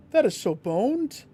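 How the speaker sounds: frustrated cry at the game; exasperated outburst. Real, not acted. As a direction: wail it out in disbelief. Loud repeat of the same words.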